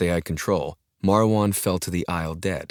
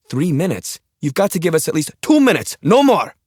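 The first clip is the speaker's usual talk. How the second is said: sharp high scream; frantic scream at the pilot; 11 semitones higher, 8 dB louder than usual. Acted. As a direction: bark the destination as a scream. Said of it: "Jelak screamed every word".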